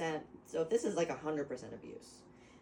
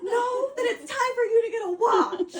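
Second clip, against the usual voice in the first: high, loud laughing words; 11 dB louder than usual. Real, not acted. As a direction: barely get it out laughing. Helpless laughter.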